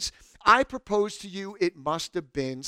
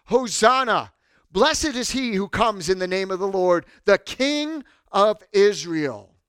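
loud acclamation, raised voice; crowd crying out; 3 semitones higher, 6 dB louder than usual. Acted. Palm-waving crowd greeting Jesus, crying out. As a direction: cry it out, stretching the final title.

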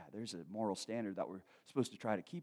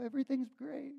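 high, sing-out mock reassurance; fake cheer. Doubter hides his struggle at church, fake cheer. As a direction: cover the trouble with put-on cheer. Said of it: "we put on a front".

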